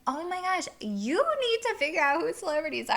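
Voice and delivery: hammed-up, high-pitched reproach; exasperated manager. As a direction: exclaim in amused disbelief at a clueless coworker. Manager acted scolding her.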